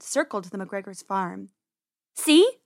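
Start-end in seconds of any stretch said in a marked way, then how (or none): 2.1-2.6 high, loud little burst; pleased, rising exclamation; triumphant. Acted. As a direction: show him you were right. Rabbit sister proves her point.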